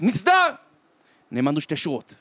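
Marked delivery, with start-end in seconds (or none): 0.0-0.5 high, very loud military order; shouted command. Acted. German soldier to the prisoners, shouted command. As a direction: shout it like a roll-call order. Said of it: "צעק".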